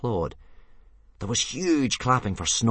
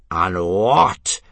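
loud, very slow, incredulous question; appalled demand; 10 dB louder, much slower than usual. A narrator's reading. Instructor on seeing the puny dragon, appalled demand. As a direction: demand it slowly, word by word. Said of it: "demanded Gobber, blanching a little".

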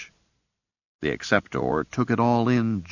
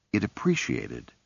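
usual speaking voice

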